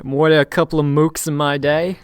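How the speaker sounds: put-on wobbly Jersey accent sliding into Cockney; muddled Jersey-Cockney accent; Jersey turning Cockney. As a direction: start mock Jersey, drift off to Cockney. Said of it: "turned into, like, a Cockney accent at the end".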